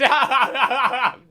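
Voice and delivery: big high burst of laughter; real loud laugh; loud cackle. Real, not acted. Laughing at own cheek.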